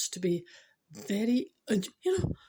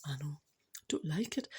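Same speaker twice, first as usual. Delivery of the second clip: whisper; 5 semitones lower, 7 dB quieter than usual. Real, not acted. Dreading tomorrow's storm.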